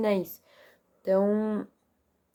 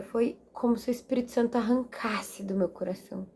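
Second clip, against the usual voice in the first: usual speaking voice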